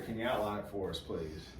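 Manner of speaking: normal talking voice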